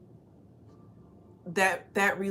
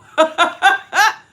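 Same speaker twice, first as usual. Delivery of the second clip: bright burst of laughter, much higher; 10 semitones higher, 11 dB louder than usual. Real, not acted. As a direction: laugh at your own joke. Amused at her own sheep joke.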